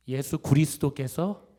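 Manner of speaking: 그리스도께서 said as 구리스도께서, loud and slow; deliberate, loud 구리스도께서 for 그리스도께서; misspelt pronunciation. Retold or imitated. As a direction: read out 그리스도께서 with 구 for 그. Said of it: "그리가 아니라 구리".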